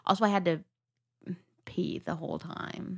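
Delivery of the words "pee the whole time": unvoiced whispered confession; embarrassed whispered aside. Real, not acted. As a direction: drop it to a whisper.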